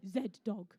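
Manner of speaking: Z-Dog with an English zed, said as zed-dog; posh English accent. Shown as a demonstration. Referee says the team name as a posh English zed-dog. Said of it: "with my posh English accent".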